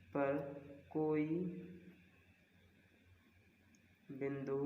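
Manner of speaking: spaced out, with long pauses between chunks; slow dictation. Shown as a demonstration. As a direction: dictate each phrase so students can copy it. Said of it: "मैं आपको एक line लिखवा देता हूँ". (रुक-रुक कर धीरे से लिखवाना)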